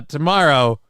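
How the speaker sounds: ordinary talk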